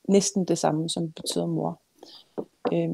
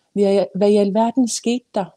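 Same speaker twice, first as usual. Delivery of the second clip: louder, agitated mother's demand; irritated; 3 semitones higher, 6 dB louder than usual. A narrator's reading. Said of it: "Ananas irritation var ikke til at tage fejl af".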